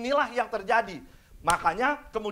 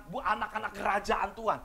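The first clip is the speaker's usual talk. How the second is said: ordinary delivery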